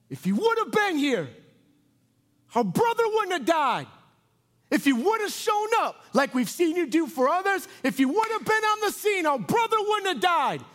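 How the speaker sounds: high, loud, accusing cry of grief; anguished outcry. Acted. Two sisters confronting Jesus over their brother, anguished shout. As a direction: cry it out in raw reproach, voice pushed way up.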